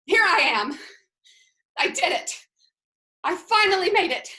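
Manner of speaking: rising, emphatic relief; triumphant arrival. Acted. Server finally reaching her waiting guests, triumphant. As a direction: announce your arrival as a hard-won victory.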